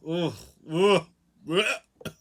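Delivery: gagging ugh sounds; repeated grossed-out ugh; disgusted groans. Real, not acted. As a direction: groan like smelling something foul. Imagining a cesspool of filth.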